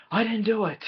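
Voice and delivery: high, pleading put-on voice; whiny denial. Professor Plum insisting he's innocent, high and whiny. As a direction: whine your innocence.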